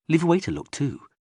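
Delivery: usual speaking voice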